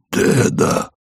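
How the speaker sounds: weak, low whispered call; voiceless whisper. A narrator's reading. Sick man calls Varvara, whispering. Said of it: "он шёпотом, без голоса, позвал её".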